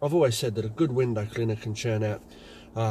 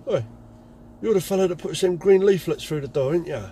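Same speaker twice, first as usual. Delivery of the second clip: put-on louder, higher old-timer call; gruff call; 7 semitones higher, 6 dB louder than usual. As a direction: call over from next door. Old man calling across.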